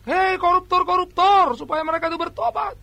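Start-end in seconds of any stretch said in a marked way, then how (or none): 0.1-1.6 higher, louder, slow prophetic cry; prophet's shout. Retold or imitated. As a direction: call out the corrupt like the prophet Amos. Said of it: "Nabi Amos itu teriak". (teriakan nabi yang lantang dan lambat)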